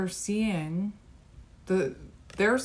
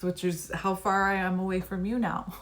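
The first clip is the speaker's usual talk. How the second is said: usual speaking voice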